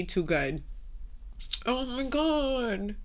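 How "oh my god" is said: slow, high, choked-up oh my God; catching her breath against tears; fighting tears. Real, not acted. Host on her podcast, real choked-up gasp. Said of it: "I'm not about to cry".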